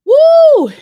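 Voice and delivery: long, loud, high-pitched whoop; full-voiced celebratory whoop; big whoop. Real, not acted. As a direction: let out a piercing drawn-out "woo". Reacting that the trial is over.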